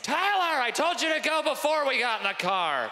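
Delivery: high-pitched angry parent yell; exasperated, high scolding shout. Acted. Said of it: "that's angry yelling".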